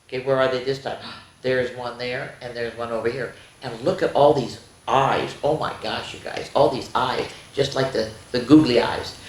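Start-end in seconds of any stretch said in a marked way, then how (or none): none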